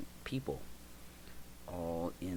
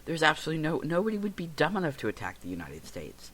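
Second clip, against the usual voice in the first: higher and louder, brushing the idea off; retelling own incredulous denial, pitched up; dismissive disbelief; 9 semitones higher, 4 dB louder than usual. Retold or imitated. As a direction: scoff at it as impossible. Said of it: "I said".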